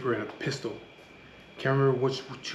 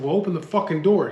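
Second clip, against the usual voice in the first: louder, higher furious order; angry retold demand; 5 semitones higher, 6 dB louder than usual. Man yelling at his cousin to open the door, angry. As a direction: bark it at him, fed up.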